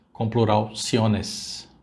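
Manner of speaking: ordinary delivery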